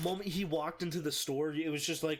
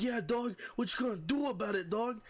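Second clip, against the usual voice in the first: high, taunting imitation of a drunk; pitched-up belligerent taunt; upset drunk; 8 semitones higher than usual. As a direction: goad them in a mock drunk voice. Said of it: "he's getting upset".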